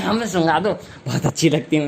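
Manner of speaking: normal talking voice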